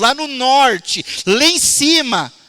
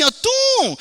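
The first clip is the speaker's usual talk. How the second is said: ear pop; 12 semitones higher than usual. Retold or imitated. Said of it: "meu ouvido tava assim".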